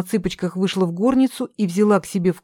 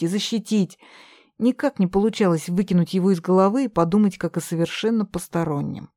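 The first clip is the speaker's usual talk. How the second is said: plain reading voice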